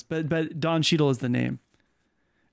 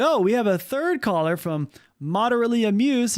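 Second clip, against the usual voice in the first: higher, mock host introducing a caller; call-in show host; 5 semitones higher than usual. Streamer announces a third caller, mock host voice. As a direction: play the live show host, pitched up. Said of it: "then you would be like".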